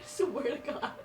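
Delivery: soft words squeezed out through laughter; breathless laugh-cry. Real, not acted. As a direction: barely get it out between laughs and sobs. Laughing and crying, overwhelmed.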